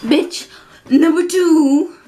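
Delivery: game-show reveal, high and loud; showy announcing. Acted. Host revealing bitch number two to the boy, high and loud. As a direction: sell the name like a prize reveal.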